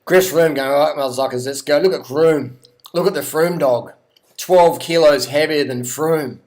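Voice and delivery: excited, punchy race call; a little excited. Real, not acted. Commentator cheering on Froome's attack, a little excited. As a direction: get carried away calling the rider's attack. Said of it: "I'm getting a little excited here".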